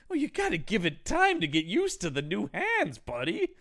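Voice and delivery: high, coaxing mock encouragement; imitated scientist, swooping pitch; coaxing Frankenstein. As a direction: cajole the patient like a buddy. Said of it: "Frankenstein when he's like".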